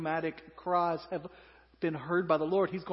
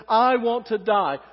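melodramatic; 5 semitones higher, 8 dB louder, slower than usual. Acted. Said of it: "Melodramatic again".

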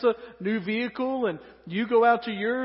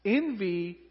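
normal talking voice

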